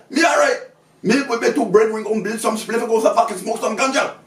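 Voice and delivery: high, loud mock West Indian accent; put-on West Indian accent. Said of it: "he chose to put that accent on".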